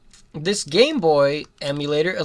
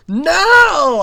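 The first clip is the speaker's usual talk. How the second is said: loud, higher cry of dismay; frustrated yell; 10 semitones higher, 8 dB louder than usual. Real, not acted. Gamer yelling as his character dies. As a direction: shout at losing.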